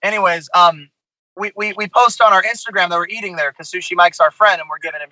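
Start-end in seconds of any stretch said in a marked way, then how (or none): none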